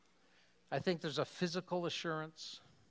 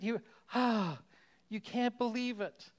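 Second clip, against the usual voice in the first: excited disbelief; 8 semitones higher, 6 dB louder than usual. Retold or imitated. Overwhelmed surprise reaction.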